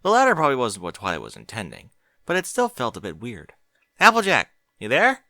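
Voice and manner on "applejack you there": loud high call. Acted. Twilight calling to Applejack from the shower, loud high call. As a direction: call out checking she's there.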